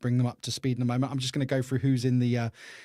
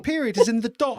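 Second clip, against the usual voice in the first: explaining; 9 semitones higher, 5 dB louder than usual. Retold or imitated. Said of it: "She's like".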